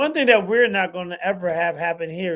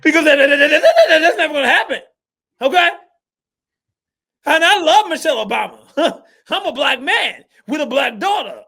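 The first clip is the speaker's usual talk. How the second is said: loud, high, preachy sports pundit ranting; hammed-up hot-take commentator, pounding every point; Stephen A. Smith impression; 6 semitones higher, 7 dB louder than usual. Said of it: "I am Stephen A. Smith".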